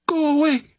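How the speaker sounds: high desperate cry; frightened cry. A narrator's reading. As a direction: cry out in fear. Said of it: "she cried".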